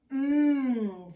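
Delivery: loud, drawn-out hum of delight; a loud, savouring mmm at the smell; hungry old man relishing the smell. Acted.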